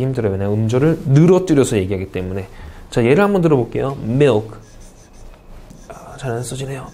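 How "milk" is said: "milk" as one single syllable; English "milk", no vowel after the k. Shown as a demonstration. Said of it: "이름절이죠?".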